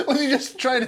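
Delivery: high, laughing through words. Real, not acted. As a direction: giggle out the question. Imagining bug's thoughts, laughing.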